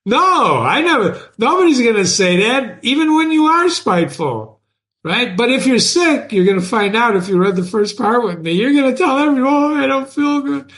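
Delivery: teacher fired up after laughing; excited. Real, not acted. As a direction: rush it out with energy.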